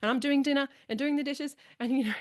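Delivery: imitating a fed-up wife listing chores; mock exasperated wife. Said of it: "They're like".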